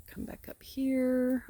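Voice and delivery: slow breathless whisper. Real, not acted. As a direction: hush it so only they hear. Whispered aside to sheep.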